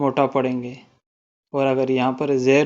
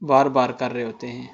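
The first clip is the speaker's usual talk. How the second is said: ordinary delivery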